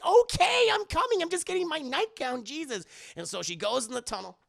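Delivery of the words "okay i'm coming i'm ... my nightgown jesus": much higher, louder exasperated lady voice; high annoyed reply. Acted. Willie answering Indy, shrill and annoyed. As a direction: toss it back like a huffy diva.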